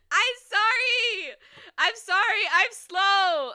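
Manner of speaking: high, loud drawn-out apology. Real, not acted. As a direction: apologize loudly and dramatically.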